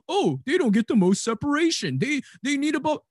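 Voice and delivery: high-pitched mock complaint; mocking whiny imitation. Host parrots people arguing about receiver separation, mockingly. Mimicking people who make the argument.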